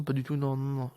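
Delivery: ordinary delivery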